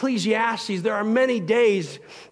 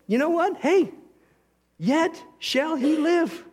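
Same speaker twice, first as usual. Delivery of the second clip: mock wonder; 9 semitones higher, slower than usual. Martha's voice, realizing with wonder.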